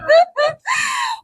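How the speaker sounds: shrieking laugh; high burst of laughter. Real, not acted. Remembering the panic, high laughing burst.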